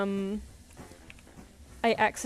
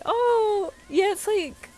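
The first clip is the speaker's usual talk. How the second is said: excited recognition; 12 semitones higher, 6 dB louder, much slower than usual. Real, not acted. Sudden recognition of a show.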